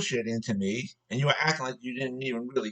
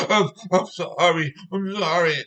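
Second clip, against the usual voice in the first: hammed-up baby tantrum, higher and sobbing; mock crying; 5 semitones higher than usual. Worker caught in his lie gets into baby temper tantrum, mock crying. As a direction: imitate a blubbering, whiny cry-baby. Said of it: "going to almost crying".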